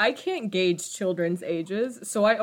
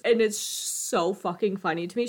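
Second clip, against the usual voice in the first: normal talking voice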